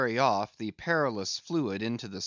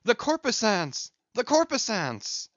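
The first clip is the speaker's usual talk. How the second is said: high, loud cry of wonder; awed cry; 10 semitones higher, 3 dB louder than usual. A narrator's reading. Starbuck crying out at the mast fires, awed and loud. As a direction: call out the marvel twice. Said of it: "cried Starbuck".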